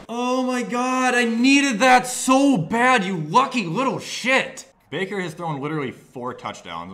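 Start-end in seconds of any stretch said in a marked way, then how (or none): 0.1-4.6 high, slow overjoyed relief; relieved gush. Real, not acted. Gamer relieved after a lucky score, slow and high. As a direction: draw out the relief.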